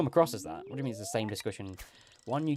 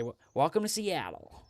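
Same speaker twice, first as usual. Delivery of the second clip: higher hammed-up American accent; 5 semitones higher than usual. Shown as a demonstration. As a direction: greet like a cheery American. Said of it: "do it in my American accent".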